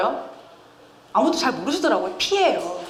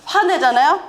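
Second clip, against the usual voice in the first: usual speaking voice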